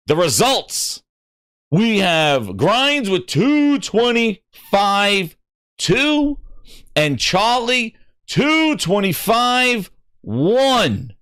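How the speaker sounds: slow, drawn-out announcer voice building up. Retold or imitated. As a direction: call the results like a host on a stage. Host announcing race results.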